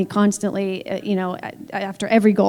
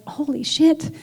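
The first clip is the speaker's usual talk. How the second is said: pitched-up, stunned exclamation; retold astonishment, voice jumping higher; stunned reaction; 7 semitones higher, slower than usual. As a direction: relive the disbelief at big news. Retelling stunned reaction to news.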